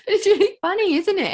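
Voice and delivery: high and loud, still laughing. Real, not acted. Host to guest, laughing high and loud. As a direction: let the laugh carry the words. Laughing after a funny story.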